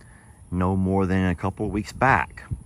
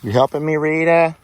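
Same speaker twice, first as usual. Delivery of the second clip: pet talk; 7 semitones higher, 4 dB louder than usual. Real, not acted. Talking to her animal Rita.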